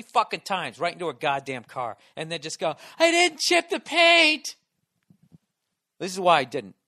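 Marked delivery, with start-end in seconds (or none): 3.0-4.5 high, loud, slow mocking voice; mocking the woman who dinged his car, high and slow; mock snooty lady. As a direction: throw her own words back at her. Mocking her line.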